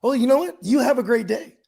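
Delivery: hammed-up professional friendliness; work voice. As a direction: play up the super-polite phone voice. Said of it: "exactly how I sound".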